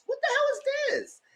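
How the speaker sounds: a very high, squeaky outraged cry; outraged disbelief. Retold or imitated. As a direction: squeal it like you can't believe it. Retelling her outrage at mute call.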